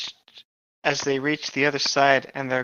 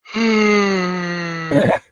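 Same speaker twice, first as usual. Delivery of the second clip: loud anguished cry; 4 semitones higher, 6 dB louder than usual. Acted.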